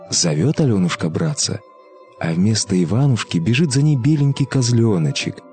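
ordinary delivery, nothing marked

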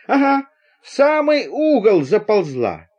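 high, drawn-out confirmation; eager report. Acted. Craftsman telling passengers where the viper crawled, eager.

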